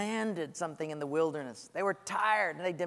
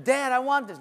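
put-on higher, louder childish whine; whining child; 6 semitones higher, 5 dB louder than usual. Child demanding a thing from dad, whining. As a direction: nag like a demanding kid.

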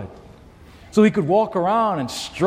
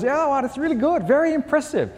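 impressed talk show host, pitched up; high-pitched imitation of an admiring host; Merv Griffin; 7 semitones higher than usual. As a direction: gush with higher-pitched approval, then ease into the question. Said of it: "And Merv goes".

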